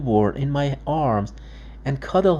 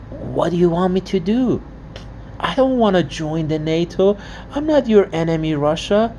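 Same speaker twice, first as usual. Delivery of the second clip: raised, pleading voice; pleading Ukraine; 5 semitones higher than usual. Retold or imitated. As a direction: plead as small country to a bully.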